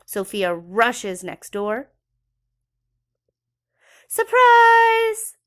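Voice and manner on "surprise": very high, loud gleeful shout; joyful shout. Acted. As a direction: yell it to spring the surprise. Child shouts surprise gift.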